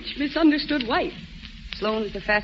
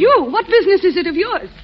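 sharp indignant retort; 8 semitones higher, 7 dB louder than usual. Acted. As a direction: bristle at the question. Snaps at Bill.

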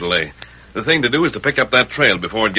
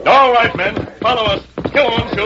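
calling out; 13 semitones higher, 3 dB louder than usual. Acted. Ranger calls out to ride off.

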